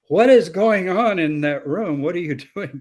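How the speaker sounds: hammed-up shout of confusion; mock family yell. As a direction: imitate a relative yelling through the door. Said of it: "your family members will yell".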